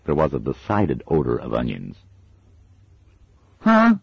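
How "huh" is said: put-on very high child's huh; a toddler not getting it; puzzled child. A narrator's reading. Little girl puzzled.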